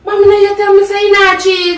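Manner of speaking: angry confrontation. Acted. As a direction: confront your mother at full voice. Daughter confronts mother about cheating.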